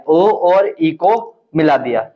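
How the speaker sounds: slow, word by word, for writing down; slow dictation. Shown as a demonstration. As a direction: dictate so students can copy. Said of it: "रचना में लिख देना आप".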